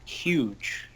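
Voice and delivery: "Huge" with a clear breathy H; "Huge" starting on an audible H; H sounded in huge. Shown as a demonstration. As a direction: say "Huge" with the H put back. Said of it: "you dropped the H".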